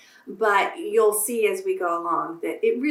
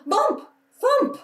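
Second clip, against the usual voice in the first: much higher, louder crash words; dramatic bump; 4 dB louder than usual. A narrator's reading. Teacher acting out the bike fall, high excited crash sounds. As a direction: pop each bump out for the kids.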